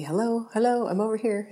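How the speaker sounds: the leftover cookie calling out to the baker, coaxing; talking cookie. Pretend. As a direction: play the cookie luring her to eat it. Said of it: "like, winking at me".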